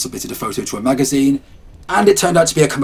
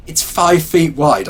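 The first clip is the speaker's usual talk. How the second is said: slow, scoffing and louder; mocking disbelief, higher and slowed; scornful disbelief; 5 semitones higher, 5 dB louder, much slower than usual. A narrator's reading. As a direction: scoff at the idea, drawn out. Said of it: "I scorned".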